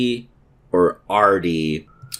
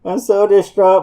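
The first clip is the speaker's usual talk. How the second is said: high, strained voice that cracks; voice cracking up an octave; 10 semitones higher, 5 dB louder than usual. Real, not acted. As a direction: voice breaking high in distress. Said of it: "My voice cracked".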